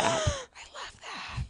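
high gasp then slow, thrilled I love that; gasping delight. Real, not acted. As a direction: gasp and savor it. Gasps at drain snake idea.

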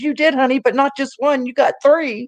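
high-pitched mock-thrilled mom; excited mother. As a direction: imitate a mom building up a big surprise. Mother announcing teasing surprise, retold.

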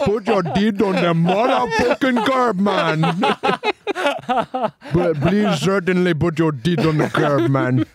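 put-on high-pitched comic Indian accent; hammed-up Indian-accented movie tough guy. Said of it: "American Indian history accent".

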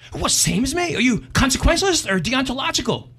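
woman's voice far up, eager; high eager woman. Acted. Woman on bridge quizzes the man, high and eager. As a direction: quiz him eagerly in a high voice.

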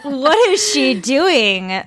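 slow, drawn-out puzzled listener; mock bewildered radio listener; imitated baffled listener. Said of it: "Their listeners would be like".

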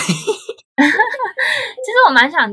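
said through a laugh; slow, laughing it out. Real, not acted. Laughs while admitting wish. (边笑边说)

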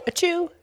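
playful fake sneeze, high pitched. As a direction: pretend to sneeze for a laugh. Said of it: "I didn't really sneeze".

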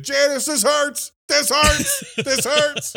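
high, drawn-out mock wail of pain; imitated screaming. Host recalls the slingshot kid during the movie, mimicked screaming. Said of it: "The whole time I was thinking".

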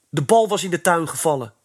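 put-on boy hurriedly defending himself; defensive. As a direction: rush to defend yourself. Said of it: "verdedigde Kees zich".

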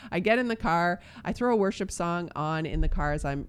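ordinary talk

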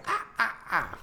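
high witch cackle. Retold or imitated. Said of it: "cackle, right?".

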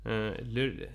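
normal talking voice

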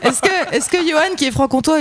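high-pitched, talking through laughter; amused, laughing. Real, not acted. Woman teased about her Franche-Comté accent, laughing as she asks. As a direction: start the question while still laughing.

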